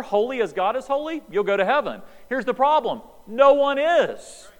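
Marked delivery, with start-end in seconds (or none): 2.3-4.5 higher and louder, "No one is" very slow; full-force preaching; preaching climax. Real, not acted. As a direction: drive it home, drawing each word out.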